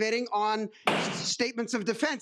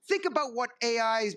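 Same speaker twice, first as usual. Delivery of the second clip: ordinary delivery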